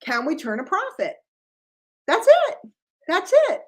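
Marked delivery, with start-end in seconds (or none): 2.1-3.6 sharp and high; emphatic exclamation. Real, not acted. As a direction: land it with a punch. Emphatic punchline of business point.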